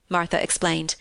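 ordinary delivery, nothing marked

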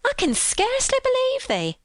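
very high-pitched astonishment; amazed maid, voice soaring; amazed protest. A narrator's reading. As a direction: protest in high, incredulous Yorkshire. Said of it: "gasped with amazement".

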